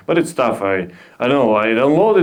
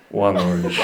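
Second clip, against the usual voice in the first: hitman confirming; 7 semitones lower than usual. Pretend. Hitman settling on one target, low grim reply. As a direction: say it low like a contract killer. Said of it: "a hitman who was ordered".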